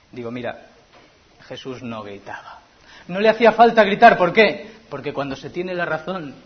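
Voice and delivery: ordinary talk, nothing marked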